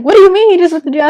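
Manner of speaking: fast, high and loud retold disbelief; imitating an alarmed mother; shocked mother. Mother hears son is jailed.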